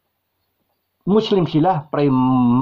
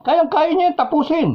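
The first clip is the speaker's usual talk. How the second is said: emphatic insistence. Real, not acted. Insists a general could end it.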